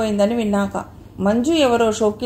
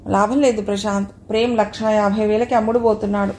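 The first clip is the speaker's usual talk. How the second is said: usual speaking voice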